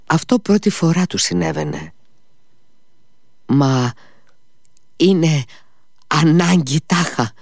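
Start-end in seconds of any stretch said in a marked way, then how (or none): 3.5-7.3 raised, higher anguished exclamation; a pained outburst, pitched high; exclaiming. A narrator's reading. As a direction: cry it out in protest. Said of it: "ξεφώνησε αυτός".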